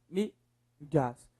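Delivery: "Mídias" slowly split into MÍ and DIAS; syllable by syllable. Shown as a demonstration. Teacher repeating the separation, "Mídias" syllable by syllable. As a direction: leave a gap inside "Mídias". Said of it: "Separe pra mim as palavras".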